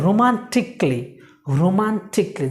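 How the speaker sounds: রোমান্টিকলি said carefully as ro-man-tik-lee; model pronunciation. Shown as a demonstration. Teacher asking learners to say it along, রোমান্টিকলি with silent A. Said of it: "just আমার সাথে বলুন please".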